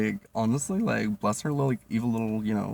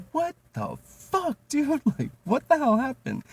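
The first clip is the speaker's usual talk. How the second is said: much higher, slow squeal of disbelief; shocked; 5 dB louder, slower than usual. Retold or imitated. Stunned server reacting to the fight, high slow squeal. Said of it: "And I was like".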